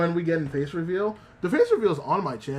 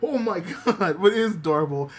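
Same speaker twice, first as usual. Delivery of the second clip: ordinary talk, nothing marked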